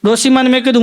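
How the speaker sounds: ordinary talk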